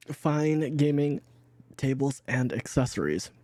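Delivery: usual speaking voice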